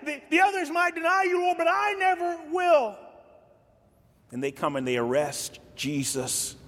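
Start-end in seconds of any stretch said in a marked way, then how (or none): 0.0-3.0 high, insistent vow; boastful Peter. Retold or imitated. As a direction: swear loyalty with eager bravado. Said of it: "And Peter says".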